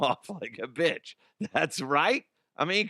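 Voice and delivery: normal talking voice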